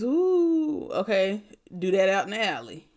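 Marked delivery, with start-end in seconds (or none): 0.0-0.9 a high, floaty mock euphoric ooh; druggy high ooh. Nurse mocking a patient wanting a high, with a high dreamy ooh. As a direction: imitate the rush of getting high. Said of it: "I'm not trying to give you this".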